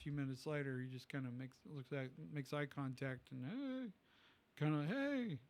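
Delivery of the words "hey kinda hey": mock signal call. Scene: signalling across the bar.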